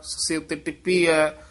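usual speaking voice

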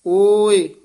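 loud, stretched ਓਏ standing alone; brief break after ਓਏ. Shown as a demonstration. Teacher marking the short break, loud long ਓਏ then pause. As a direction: hold ਓਏ loudly, then pause. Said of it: "ਇੱਥੇ ਯਮਕ ਹੈ".

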